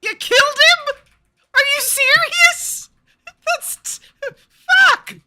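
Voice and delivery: high, loud stunned outrage; you can't believe he's dead; shocked disbelief. Real, not acted. Shocked reaction to dead operator.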